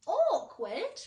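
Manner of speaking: loud, high outraged echo; indignant cry. A narrator's reading. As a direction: fling the word back in disbelief. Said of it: "cried the earthworm".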